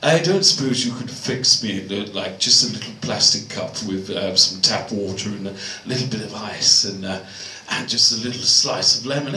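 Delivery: low, drawn-back understated request; customer to bloke. Retold or imitated. Customer asking bloke Raoul for tap water, low and hesitant. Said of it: "he said to this bloke".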